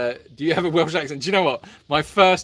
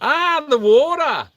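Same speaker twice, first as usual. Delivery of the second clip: high, loud, drawn-out Australian accent; 9 semitones higher, 6 dB louder, slower than usual. Shown as a demonstration. Answering a co-host's crocodile question, exaggerated Australian accent. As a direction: stretch it out in a hammed-up Australian accent. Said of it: "hear your version of the accent".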